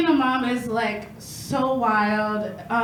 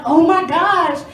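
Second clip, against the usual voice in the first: bewildered mom; 4 semitones higher, 6 dB louder than usual. Retold or imitated. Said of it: "I'm like".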